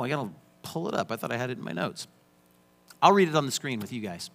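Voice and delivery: normal talking voice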